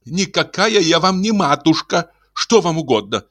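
high, brisk female rebuff; stern wife. Acted. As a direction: reject the endearment as lady of the house. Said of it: "сказала она грозно".

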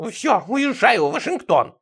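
put-on high, drawn-out huffy old neighbour; decisively offended. A narrator's reading. Сидорыч announcing he leaves for Washington, offended high voice. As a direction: announce it in a high, slow huff. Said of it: "решительно обиделся Сидорыч".

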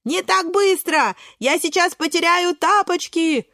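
high, drawn-out complaint on the run; calling out. A narrator's reading. Брусничка about to lose slippers, high complaining cry. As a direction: call after them in a high voice. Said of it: "кричит Брусничка".

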